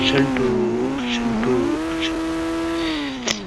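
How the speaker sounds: high, long-held call of a name; drawn-out high-pitched call. Pretend. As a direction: call after someone, stretching the last cry way up high. Calls after runaway character Shantu.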